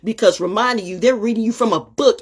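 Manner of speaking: normal talking voice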